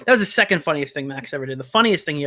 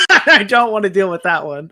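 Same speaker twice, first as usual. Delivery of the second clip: loud, higher-pitched words through real laughter; laughing refusal; 5 semitones higher, 8 dB louder than usual. Real, not acted. Host turning down a risky link, laughing in alarm. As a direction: refuse while cracking up.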